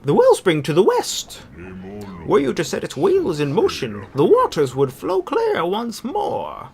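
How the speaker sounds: squeaky nerd voice for the forest spirit; high-voiced nerd voice. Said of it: "this high-voiced nerd voice".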